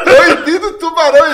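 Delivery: the penny drops, call it out; shouting the sign's warning in alarm; alarmed realisation. Retold or imitated. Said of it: "Olha eu".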